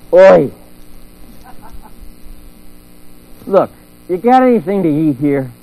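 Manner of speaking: loud cheeky call for food; casual Jesus shout. Retold or imitated. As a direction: bellow it like a hungry mate. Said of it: "I imagine him kinda going".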